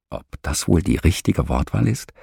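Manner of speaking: plain reading voice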